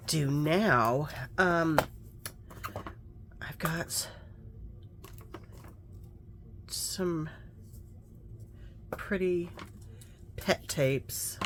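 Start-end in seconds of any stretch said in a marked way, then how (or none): 3.4-11.4 hushed, breathy aside while crafting; whisper. Real, not acted. Murmuring to herself over the journal, whispered. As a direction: drop to a soft whisper.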